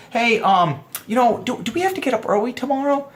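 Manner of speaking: high, tentative imitation of a woman; hinting woman. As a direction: pitch it up and drop a polite hint. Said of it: "She's giving you a hint".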